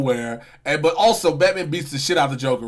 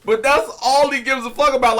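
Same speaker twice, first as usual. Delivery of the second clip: ordinary talk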